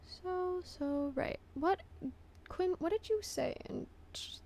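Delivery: whispering. Real, not acted. Whispered to friend.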